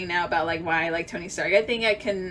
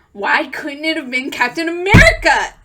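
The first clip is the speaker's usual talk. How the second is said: forceful stage whisper, much louder; loud indignant whisper; 6 semitones higher, 10 dB louder than usual. Real, not acted. Fan protesting Iron Man's death in a loud whisper. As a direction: hiss the complaint with force.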